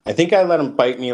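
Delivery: ordinary delivery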